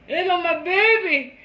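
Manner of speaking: high, loud doting grandma; grandma doting over her grandchild; grandma's voice. Retold or imitated. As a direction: coo it like a proud grandma. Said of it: "my grandma used to look at me".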